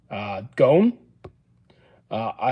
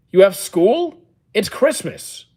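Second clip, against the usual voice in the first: high-pitched incredulous question; taken aback, voice lifting high; real disbelief; 7 semitones higher, 3 dB louder, slower than usual. Real, not acted. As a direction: react in disbelief, pitch jumping up. Streamer shocked viewer has school on Christmas.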